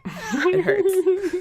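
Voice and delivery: high, laughing complaint; laughing whine. Real, not acted. Confessing a butt pimple to friends, laughing whine. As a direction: squeal it through embarrassed laughter. Admitting a silly painful pimple.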